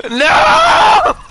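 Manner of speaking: very high, very loud drawn-out scream; long piercing gamer scream. Real, not acted. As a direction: scream it at the top of your voice. Screams at game mishap.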